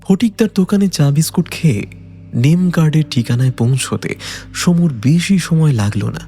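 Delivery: ordinary talk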